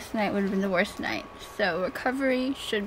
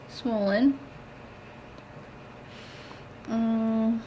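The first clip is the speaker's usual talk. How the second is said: ordinary talk, nothing marked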